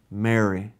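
soft and low, a gentle name; tender call. Retold or imitated. Jesus gently calling Mary by name, soft and tender. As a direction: say her name softly, with love. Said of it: "this is beautiful".

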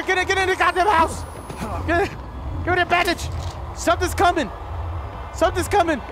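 high frantic panic; urgent scared shouts; panicked shouting. Real, not acted. Panicked in horror game.

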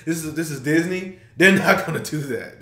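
ordinary talk, nothing marked

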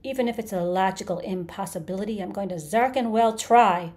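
scream. A narrator's reading. Creature vows to destroy everything, screaming. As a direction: start loud and climb into screaming. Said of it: "he screamed".

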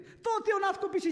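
high, sharp complaint; indignant mother. Retold or imitated. Scene: mother complains her turn to name is lost, indignant. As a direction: bristle at being passed over. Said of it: "S-o necăjit mama".

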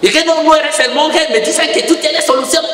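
demanding. Retold or imitated. Grieving man confronts the monk.

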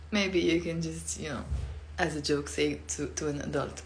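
ordinary delivery

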